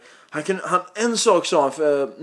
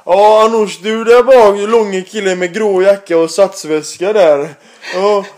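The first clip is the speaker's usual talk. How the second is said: loud, bus-driver announcement over the mic; mock bus announcement; 3 semitones higher, 7 dB louder, slower than usual. Dad on the bus mic calling out his son loudly. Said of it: "ta mikrofonen och börja säga".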